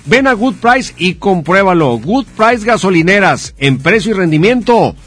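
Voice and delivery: upbeat radio sponsor read. Acted. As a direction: sell it like a radio spot. Host reads sponsor ad.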